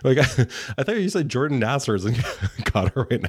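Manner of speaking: talking through real laughter; laughing. Real, not acted. Telling co-host about a misheard name, laughing. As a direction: laugh breaking into the words.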